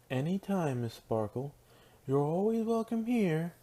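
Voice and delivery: lightly voiced shopkeeper, lifting high at the end; friendly shopkeeper. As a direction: welcome a regular customer with rising pitch.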